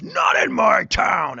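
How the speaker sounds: slow, loud, high-pitched shout; cop's shout. Retold or imitated. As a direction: bellow it like a cop making an arrest. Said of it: "slams him into an iron fence, and goes".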